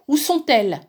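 high, loud anxious cry; cries out. A narrator's reading. The old woman running up, crying out to her husband. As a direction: call out to him urgently. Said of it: "s'écria".

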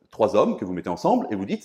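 normal talking voice

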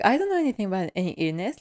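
rattled off fast, feigning ignorance; mock innocent denial. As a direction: rush it like a bad liar. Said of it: "how he acts like".

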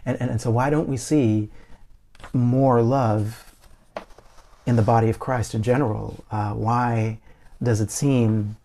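normal talking voice

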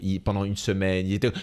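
ordinary talk, nothing marked

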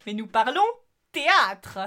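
ordinary talk

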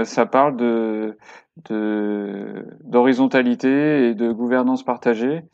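ordinary delivery

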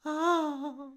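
high, put-on farm wife's sigh; maîtresse désolée. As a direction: sigh it out as the sorry farm wife. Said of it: "dit la maîtresse". (un « ah » aigu de fermière désolée)

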